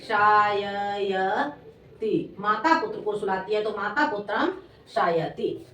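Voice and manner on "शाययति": शाययति stretched very slowly, each syllable drawn out. Shown as a demonstration. Teacher dictating to pupils, शाययति slowly syllable by syllable.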